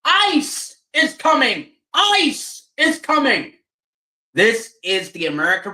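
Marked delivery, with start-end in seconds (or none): 0.0-3.5 slow, high warning call; a mild mock night-time alarm cry; yelling out. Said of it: "yelling out".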